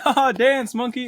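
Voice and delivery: high loud mock crowd call. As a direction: mimic a jeering crowd. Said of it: "That's what they say".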